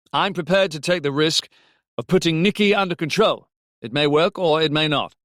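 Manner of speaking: a touch of urgency, standing his ground; firm Mac. A narrator's reading. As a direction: argue the case firmly. Mac arguing with Robbie.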